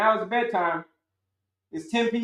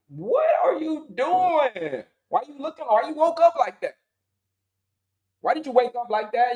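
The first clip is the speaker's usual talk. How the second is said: baffled spouse; 6 semitones higher than usual. Retold or imitated. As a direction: play the confused spouse staring at you. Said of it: "your spouse is gonna be looking at you mad crazy".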